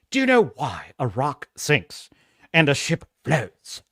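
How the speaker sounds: low, mock-posh English thespian; melodramatic posh English. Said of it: "so melodramatically".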